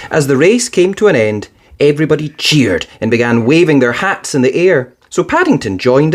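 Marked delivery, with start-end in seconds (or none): none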